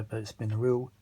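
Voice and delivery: ordinary delivery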